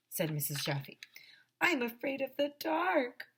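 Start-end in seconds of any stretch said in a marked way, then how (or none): 1.6-3.2 put-on high little-kid whine; high-pitched childish whimpering complaint; whining first grader. A narrator's reading. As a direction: whine it like a frightened small child. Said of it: "whined one of the first graders".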